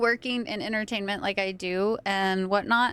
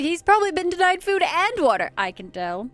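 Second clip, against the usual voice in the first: mock concerned voice; 7 semitones higher, 4 dB louder than usual. Mimicking the game character's line.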